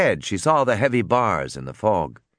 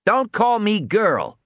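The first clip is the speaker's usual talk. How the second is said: high, drawn-out retort; raised-pitch yell of objection; shouting back; 11 semitones higher, slower than usual. A narrator's reading. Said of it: "she shouted back".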